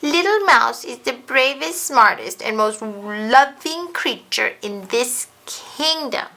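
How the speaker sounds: loud, tearful defiant shout, slowing at the end; yelled back. Acted. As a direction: shout back holding back tears. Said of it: "Ganesh yelled back".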